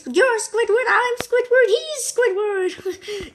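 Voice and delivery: high, sing-song mock voices; playful cartoon voices. SpongeBob and Patrick confusing the buyer, high and playful. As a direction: point around, high and teasing. Reenacting the opposite day scene.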